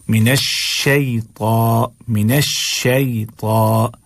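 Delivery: very slow "शैता" with glide and heavy ta; shay and thick ta. Shown as a demonstration. Teacher modelling for students, slow "शैता" with clear glide. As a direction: stretch "शैता", y glide then full taw. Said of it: "शै नहीं पढ़ना है".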